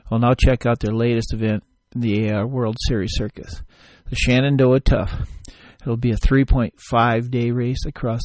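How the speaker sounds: loud, upbeat sponsor read. Acted. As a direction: sell it like a radio spot. Ad read for a race.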